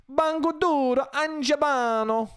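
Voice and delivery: high, slow mock Italian gibberish; fake Italian. Comedian faking Italian after disclaiming it, high mock Italian. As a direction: stretch out made-up words in an exaggerated Italian accent. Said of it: "If you thought that was real Italian".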